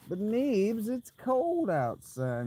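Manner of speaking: slow, mock-whiny plea; lightly voiced whiny friend; imitating Shaggy. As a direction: drawl it like a pleading friend. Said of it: "Shaggy said".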